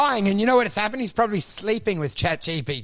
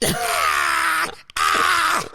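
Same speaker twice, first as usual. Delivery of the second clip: drawn-out mock screech; imitated robot screech; 10 semitones higher than usual. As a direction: screech like a distressed machine. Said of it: "it's making a screeching sound".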